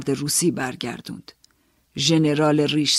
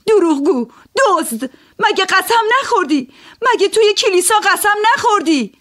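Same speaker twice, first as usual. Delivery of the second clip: very high, loud outraged yell; furious accusing shout; 7 dB louder than usual. Acted. Woman screams accusations at the oath-breaking gambler, furious high shout. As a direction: hurl the accusation across the room. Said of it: "داد زدم".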